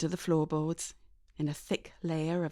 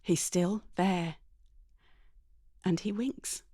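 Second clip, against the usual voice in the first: plain reading voice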